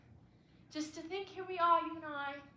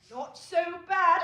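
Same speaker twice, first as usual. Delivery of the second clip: slow, drawled deadpan reply, a touch louder; mock witty [name]; 4 semitones higher, 8 dB louder, slower than usual. Wife imitating her husband's deadpan quip, mock drawl. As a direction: send up the husband's dry wit. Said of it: "say something terribly witty like".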